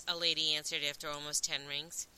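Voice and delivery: plain reading voice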